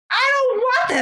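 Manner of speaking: put-on high, agitated refusal from an old lady; angry old woman. Old woman storming into the house, high and agitated. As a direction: voice the old woman bursting in, shrill with upset.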